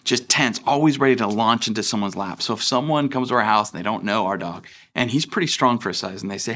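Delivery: usual speaking voice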